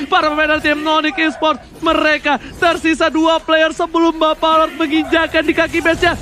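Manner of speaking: ordinary talk, nothing marked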